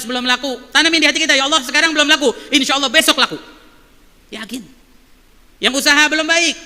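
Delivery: fervent preaching. Acted. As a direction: preach it with rising fervour to the congregation. Rising exhortation to traders.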